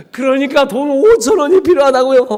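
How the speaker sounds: frustrated demand. Retold or imitated. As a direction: demand the money in exasperation. Insisting to God.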